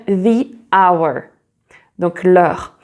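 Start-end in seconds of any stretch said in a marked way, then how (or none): 0.1-1.2 "the" as thee, "hour" with silent h; English "the hour". Shown as a demonstration. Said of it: "Et alors là, on va dire".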